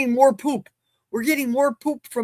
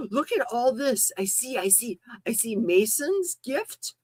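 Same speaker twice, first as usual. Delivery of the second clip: ordinary delivery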